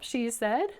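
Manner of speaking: ordinary delivery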